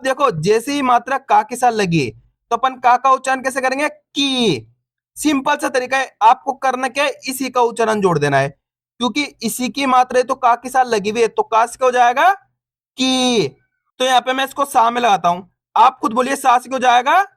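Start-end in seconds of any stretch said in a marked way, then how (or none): 3.9-4.7 long, stretched "की"; "की" with a sustained long ee; drawn-out vowel. Shown as a demonstration. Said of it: "बड़ी ई की मात्रा को खींच के पढ़ा जाता है". 12.3-13.5 long, stretched "की"; "की" with a sustained long ee; drawn-out vowel. Shown as a demonstration. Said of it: "बड़ी ई की मात्रा को खींच के पढ़ा जाता है".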